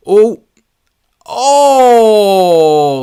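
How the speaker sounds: a sudden, loud, high drawn-out oh; loud groaning oh. Real, not acted. Streamer reacting to a near miss on the live match, loud drawn-out oh. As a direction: groan at the chance going begging.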